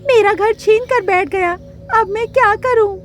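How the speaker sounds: high-pitched storybook lament; distressed little bird. A narrator's reading. Bird cheated out of her home, distressed. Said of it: "बहुत दुखी हो गई".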